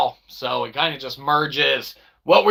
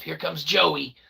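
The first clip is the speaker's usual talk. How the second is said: usual speaking voice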